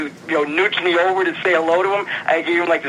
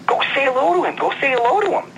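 urging friend; 11 semitones higher, faster than usual. Retold or imitated. Friend urging him to approach.

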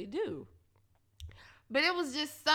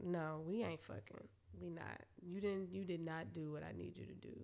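quiet disgusted; 7 semitones lower, 11 dB quieter than usual. Retold or imitated. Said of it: "I was like".

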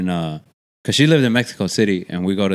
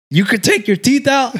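put-on higher, childlike amazed question; imitating little girl; 13 semitones higher than usual. Cousin's little daughter asking about removable braces, mock childlike amazement. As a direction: play the six-year-old, wide-eyed. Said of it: "she goes".

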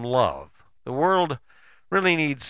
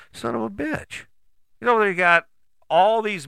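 higher-pitched mock cursing; Donald Trump pissed off; 7 semitones higher, 4 dB louder than usual. Imagining a politician who has been paid off, mock pissed-off. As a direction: curse in mild, high-pitched exasperation. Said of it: "why Donald Trump was so pissed off".

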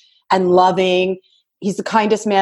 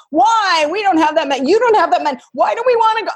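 spouse squirming in protest; 9 semitones higher, faster than usual. Retold or imitated. Wife retelling husband's protest, high and flustered. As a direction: play the nervous husband balking at the dealership. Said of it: "squirming like a fish on a hook".

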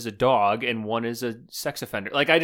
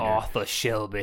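mock British gangster; 3 semitones lower, 4 dB quieter than usual. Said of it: "with a British accent".

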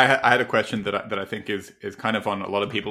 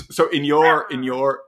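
lightly put-on old man voice, higher; 6 semitones higher, 7 dB louder than usual. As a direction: creak it out like a little old man. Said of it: "that's my old man voice".

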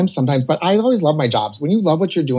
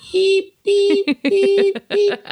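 high bleeping noise; censor bleep; much slower than usual. Retold or imitated. Censoring sound, bleep imitation.

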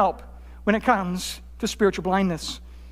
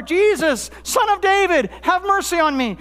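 higher, more insistent retold cry; crying out all the more; 7 semitones higher than usual. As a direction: call out harder over a crowd trying to silence you. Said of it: "he cries out all the more".

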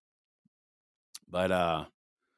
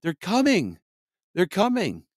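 high, shrill-free mock panic, much higher pitch; panicked neighbor; 11 semitones higher, 3 dB louder than usual. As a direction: play a neighbour freaking out over aliens. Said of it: "they're like".

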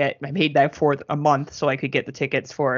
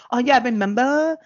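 mocking nostalgia; 6 semitones higher, 3 dB louder than usual.

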